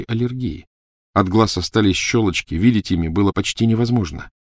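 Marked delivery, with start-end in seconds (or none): none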